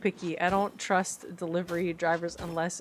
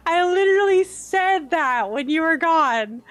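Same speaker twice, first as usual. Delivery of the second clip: louder, stress on literally; insist, raised voice; emphatic repeat; 7 semitones higher, 8 dB louder than usual. Real, not acted. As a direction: hammer the point home to Chip.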